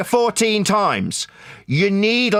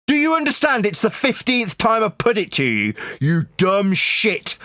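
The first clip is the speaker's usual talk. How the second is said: usual speaking voice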